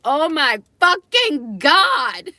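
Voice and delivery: drawn-out, high, loud gasp of disbelief; slow it right down and let the shock explode; stunned disbelief. Retold or imitated. Telling friends about grandpa's offer, stunned loud disbelief.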